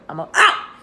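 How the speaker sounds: sudden high yelp; startled. Real, not acted. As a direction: jump at the dog's interruption. The dog interrupts the reading.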